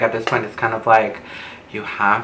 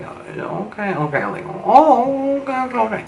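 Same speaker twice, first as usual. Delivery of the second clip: mock surprised okay; 11 semitones higher, 3 dB quieter than usual. Mock reaction to spotting locals.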